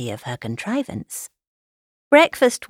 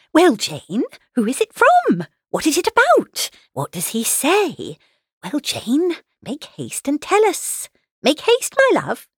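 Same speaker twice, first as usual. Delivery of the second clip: high, impatient questions from an excited mother; eager calling out; 9 semitones higher than usual. A narrator's reading. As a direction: press daughter eagerly, pitch leaping up. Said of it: "she was eagerly calling out".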